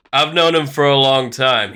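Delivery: usual speaking voice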